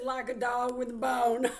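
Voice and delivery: relaying it loudly through laughter; laughing quote. Retold or imitated. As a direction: blurt it out with a laugh. Laughs while relaying his words.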